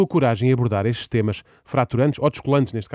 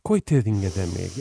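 mock pity; 7 semitones lower, slower than usual.

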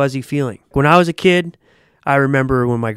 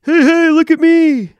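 high, loud, bratty call for attention; mocking show-off; 8 dB louder than usual. Mocking attention-seeking golfers with a high, loud look-at-me call. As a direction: mimic a clown begging to be noticed. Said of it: "No one trying to be like".

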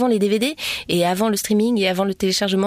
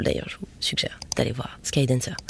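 whisper; 7 semitones lower, 7 dB quieter than usual. Real, not acted. Aside to viewers.

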